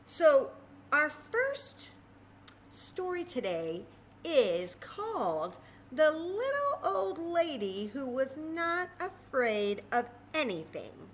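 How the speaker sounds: slow, drawn-out storybook title; storytime title. Acted. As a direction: announce the title slowly to the children. Reading title to children.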